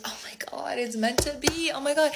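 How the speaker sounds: high, breathy swoon; half-whispered starstruck squeal, played up; mock gushing. Freshman gushing over her date, mock gushing.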